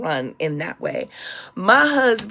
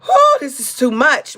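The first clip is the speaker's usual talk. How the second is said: overwhelmed; 5 semitones higher, 6 dB louder than usual. Retold or imitated. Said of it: "I was just like".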